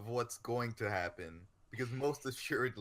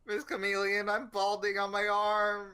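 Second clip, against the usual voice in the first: put-on 1 whiny kid voice; 9 semitones higher, 5 dB louder than usual. Kid complaining to teacher.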